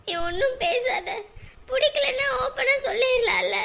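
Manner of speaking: high, sobbing outburst; crying. Acted. Young woman cries at the man who ignores her calls, high and sobbing. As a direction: cry it out at him. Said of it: "இப்ப எதுக்கு அழுதுட்டு இருக்க".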